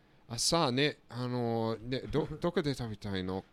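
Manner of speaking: fast Japanese mid-sentence; switching to Japanese. Retold or imitated. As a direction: rattle it off in Japanese. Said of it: "code switch".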